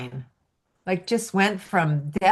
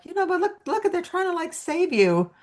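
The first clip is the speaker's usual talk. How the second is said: much higher, sing-song pet talk; high baby talk to a sad dog; coaxing a dog; 11 semitones higher than usual. Retold or imitated. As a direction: coo at the dog as if it were a baby. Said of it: "And I'm like".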